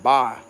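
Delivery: "bye" for bayou, one syllable; "bye" as Cajuns say bayou. Shown as a demonstration. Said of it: "Like that".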